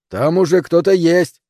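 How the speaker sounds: loud, high alarmed call; shouting. Acted. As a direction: shout a warning over the storm. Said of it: "крикнул Бен". (громкий крик)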